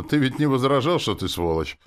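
high-pitched, loud jab; spiteful. A narrator's reading. Demon taunting her host, spiteful. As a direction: needle him with malice. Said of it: "ответила демон злобно".

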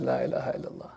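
hushed and crestfallen, the words barely spoken; dismayed murmur. Retold or imitated. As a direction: murmur it to yourself in disbelief. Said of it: "I looked at that and I said".

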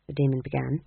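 ordinary delivery

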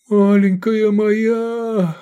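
high, loud, long-drawn howl of grief; anguished slow cry; despairing wail. A narrator's reading. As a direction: wail over a dying loved one. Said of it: "отчаянно завыл он".

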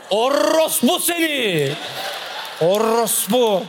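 mother yelling insults at a TV character; mother cursing at the TV. Retold or imitated. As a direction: scold the soap villainess like a furious Turkish mum. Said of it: "Wie kann die das Ranjit antun".

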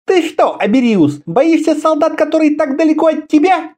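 high-pitched female taunt; mocking. A narrator's reading. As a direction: jeer at his cowardice in a high voice. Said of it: "издевательски спросила она". (издевательски, высоким женским голосом)

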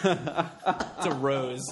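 laughing. Real, not acted. Joking boast, laughing.

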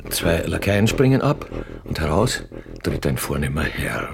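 stage directions read. A narrator's reading. Narrator reads out the arrival of the royal train, stage voice. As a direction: announce the scene like a stage narrator.

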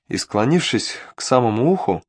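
plain reading voice

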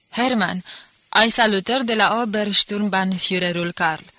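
shouting. Acted. Nicolae wakes the sleeping spy with a loud, mocking shout. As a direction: jab him awake with a sharp, loud call. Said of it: "îi strigă".

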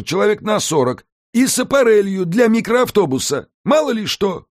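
a scheming house spirit pitching the plan; Alfred the house spirit. A narrator's reading. Alfred to Mika, laying out the island scheme, in a high lively voice.

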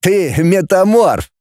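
high, slow, drawn-out exclamation; exclaiming. A narrator's reading. Арьков tells his friend he transformed, exclaiming. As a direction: announce the discovery, stretching it out. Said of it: "воскликнул Арьков".

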